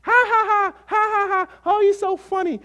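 high, hammed-up fake laughter; mock a crowd laughing at a joke pastor; mock laughing churchgoer. Mocking a congregation cackling at a funny preacher. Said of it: "making people laugh their heads off".

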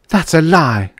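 high, loud angry denial; blazing. A narrator's reading. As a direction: flare up at the accuser. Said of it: "she blazed at him".

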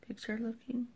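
unsure whisper to herself. Real, not acted. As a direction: breathe the words out, doubtful. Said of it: "I don't know".

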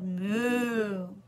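low, drawn-out moo; long storybook cow noise; cow's moo. A narrator's reading. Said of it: "Cow said".

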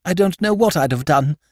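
put-on high, eager young toff; lord Drever voice. Young lord approaching Jimmy's table, high eager toff voice. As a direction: pitch it up like a flustered young aristocrat.

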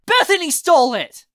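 high, loud outraged yell; furious shout. Acted. Raz blames Bethany to uncle, furious shout. Raz yells back, jumped up.

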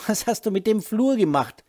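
high, loud furious demand. A narrator's reading. Laura furiously confronts Holger about the hallway. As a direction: demand an answer in rage. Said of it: "unermessliche Wut".

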